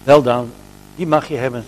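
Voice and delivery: ordinary delivery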